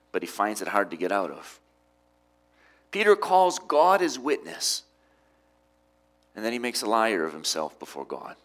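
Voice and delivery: ordinary talk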